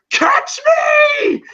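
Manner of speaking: very high mock scream; Rocky's scream. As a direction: yell it like someone flung into the crowd. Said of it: "hear Rocky screaming".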